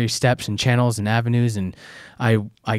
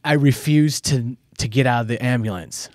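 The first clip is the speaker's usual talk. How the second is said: ordinary talk, nothing marked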